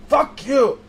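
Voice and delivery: mock furious outburst; angry yell. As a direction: snap it at Death. Yells at Death.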